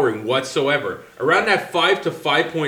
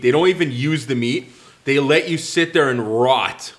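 normal talking voice

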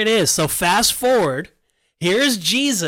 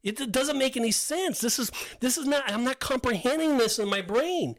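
higher-pitched, baffled protest; mildly exasperated arguing back; acted refusal; 5 semitones higher, 4 dB quieter than usual. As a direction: object as if it makes no sense. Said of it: "And he's like".

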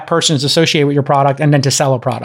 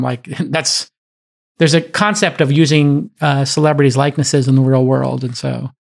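ordinary delivery

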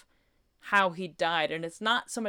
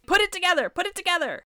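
high, loud retold yell; urgent, high-pitched scolding shout; exasperated shouting; 13 semitones higher, 7 dB louder, faster than usual. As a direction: re-enact yelling at a TV character. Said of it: "I was just shouting".

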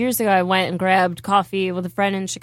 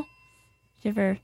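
ordinary talk

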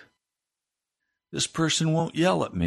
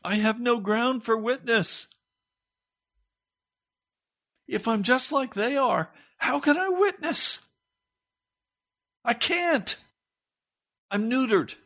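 climbing higher in pitch, preaching hard; preacher climbing to a high cry; rising preaching fervor; 9 semitones higher than usual. Real, not acted. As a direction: build the plea to a peak. Preacher building to climax.

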